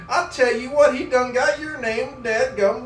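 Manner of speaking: loud, high, slow country drawl; hammed-up country holler; brother's country drawl. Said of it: "he talks like this every time".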